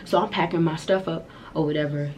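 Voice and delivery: normal talking voice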